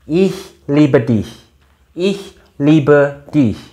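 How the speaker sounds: Ich liebe dich slowly, ch breathed like h; slow it down for the class; slow German demo. Shown as a demonstration. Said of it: "ini bacanya apa?".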